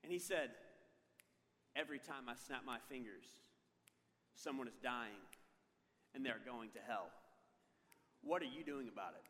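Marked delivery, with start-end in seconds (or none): 1.7-9.2 low, measured, ominous preacher's warning; grave guest preacher. Retold or imitated. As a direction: imitate a solemn preacher, low and spaced out with long pauses.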